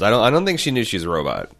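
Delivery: usual speaking voice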